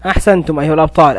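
normal talking voice

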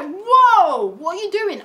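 scared shout at the ghost; freaked-out shout. Retold or imitated. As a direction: yelp like you just saw a ghost. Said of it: "I'm freaking out".

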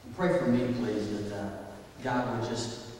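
soft, low prayer voice. Acted. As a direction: pray quietly over the room. Pastor leads opening prayer.

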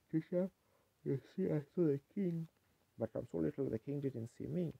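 retold child's plaint, lifted in pitch without real sobs; weeping little girl. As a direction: voice a little girl pleading with the teacher. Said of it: "just weeping her little heart out".

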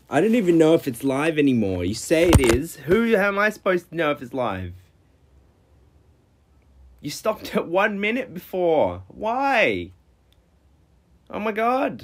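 real irritation climbing in pitch; exasperated outburst. Real, not acted.

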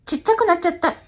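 high, all-out gag voice; voice changed for the gag. Acted. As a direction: break out of your shell and belt the gag. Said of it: "なんで声変わんだよ".

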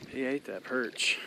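ordinary delivery

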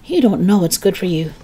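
plain reading voice

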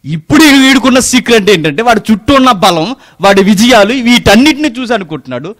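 high, loud, fast preaching; fiery preaching. Acted. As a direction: drive the point home to the congregation. Preaching at full force. (ఉద్రేకంగా బిగ్గరగా ప్రసంగం)

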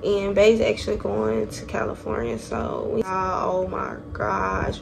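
normal talking voice

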